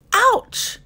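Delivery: sudden high yelp; cry of pain. Acted. Platypus, pinched on the toe by a crab, yelps high. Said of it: "he cried".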